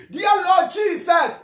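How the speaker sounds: high, slow cry to heaven; fervent prayer cry. Shown as a demonstration. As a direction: call out the prayer for others to follow. Leading a prayer of repentance.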